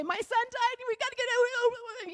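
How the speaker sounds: high-pitched hammed-up panic; hysterical mock wail. Preacher mimicking what the mother didn't do, hysterical. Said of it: "She did not start screaming, yelling, crying".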